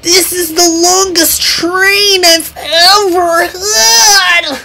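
high, slow strained complaint; grunting with effort. Acted. Jackie hauling a very long train, grunting with effort. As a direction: strain it out under a heavy load. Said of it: "grunted Jackie".

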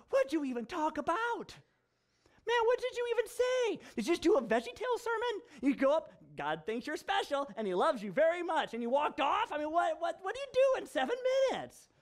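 high, rising mock disbelief to a near shout; incredulous. Pastor grilling his friend over a seven-minute sermon, incredulous. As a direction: pile on the disbelief, voice climbing.

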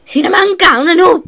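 loud self-mocking wail; frustrated shout. Real, not acted. Gamer shouting at herself over a loss. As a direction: yell at your own bad play.

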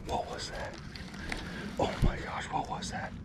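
hushed startled whisper. Real, not acted. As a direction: breathe it out in surprise. Spotting something in the water.

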